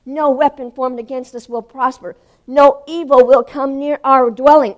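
battle-cry confession of faith; forceful quoting. Retold or imitated. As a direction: declare scripture at full volume. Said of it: "I've been quoting".

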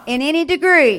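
loud, ringing declaration for the church to echo; emphatic call to repeat. Shown as a demonstration. Preacher leading the church in a loud declaration. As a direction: lead the congregation, each word hammered. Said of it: "say this with me".

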